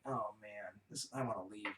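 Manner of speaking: low, soft, let-down grumble; deflated mumble. Retold or imitated. The gang disappointed by the dumpy room, a low soft grumble. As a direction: sag like the party's a bust. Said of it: "it's, like, just a depressing".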